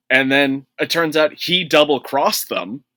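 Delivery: ordinary talk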